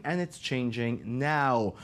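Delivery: "changing now" low and slow; low dramatic stress. Acted. Dramatic promo build.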